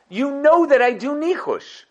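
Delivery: hammed-up exasperated ruler; Yosef scolding. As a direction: scold the brothers as if they should have known better. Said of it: "Rashi says".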